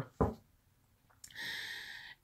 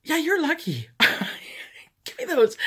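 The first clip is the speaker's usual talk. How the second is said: mock scolding; 12 semitones higher, 4 dB louder than usual. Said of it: "And I was like".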